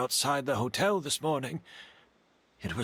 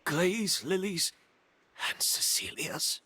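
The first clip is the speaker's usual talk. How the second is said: slow, rising disbelieving repeat; hushed incredulous repetition; stunned disbelief; 5 semitones higher than usual. Acted. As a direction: echo each ingredient as if it can't be true.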